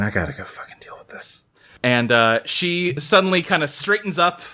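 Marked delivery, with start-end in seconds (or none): 0.0-1.4 muttered aside. Acted.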